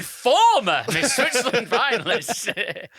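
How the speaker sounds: slow, loud, breaking into laughter; laughing through a reread. Pretend. Host rereads the headline on request, laughing. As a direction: try the read again but crack up. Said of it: "with emphasis on husband".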